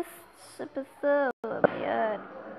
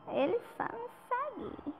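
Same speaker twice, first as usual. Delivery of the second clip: high, whining and quiet; whiny complaint; 8 semitones higher, 7 dB quieter than usual. Real, not acted. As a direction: whine it to the viewers. Repeats it, higher and whining.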